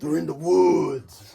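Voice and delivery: slow dramatic horror movie voice; like a line from a zombie show; spooky movie line. Acted. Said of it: "funny Walking Dead line".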